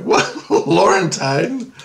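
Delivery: slow rising, baffled echo; retold disbelief. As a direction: repeat it back as a laughing question. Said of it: "And I'm like".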